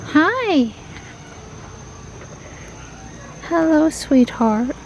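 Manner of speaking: high, slow cooing at a pet; pet talk. Real, not acted. Greeting the cats.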